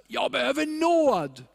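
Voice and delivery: acted shout, pitched well up; crying out. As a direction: cry it out as a desperate beggar would. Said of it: "Så han ropade".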